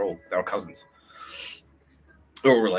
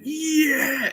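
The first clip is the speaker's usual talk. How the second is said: very high, loud mock cheer; crowd cheering; 5 dB louder than usual. Voicing all of Iran on the god king news, high loud cheer. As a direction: squeal it like an elated crowd.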